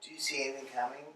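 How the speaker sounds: fast, barely audible question; quiet. Acted. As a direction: mumble it too soft to catch.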